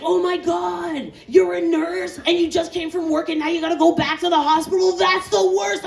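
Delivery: high, breathless gush of horror; mock-horrified bystander; gushing sympathy.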